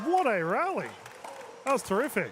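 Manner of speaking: very high, loud exclamation; thrilled commentary. Real, not acted. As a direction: cry out in real admiration for the point. Commentator reacts to a great rally.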